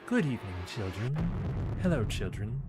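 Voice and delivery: British greeting at normal pitch; British accent. Shown as a demonstration. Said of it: "British accent".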